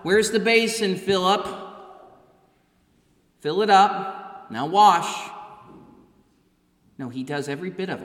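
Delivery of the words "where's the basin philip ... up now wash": louder, raised-pitch commands to servants; bossy master giving orders. Pretend. Preacher voicing a demanding master who orders the disciples around, mock bossy. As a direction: bark out chores like a lord at the head of the table. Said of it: "He doesn't say".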